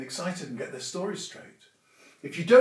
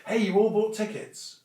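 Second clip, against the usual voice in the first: teasing nudge; 6 semitones higher, 4 dB louder than usual. Pretend. Nudging a friend to join in.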